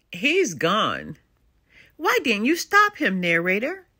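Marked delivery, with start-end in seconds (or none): none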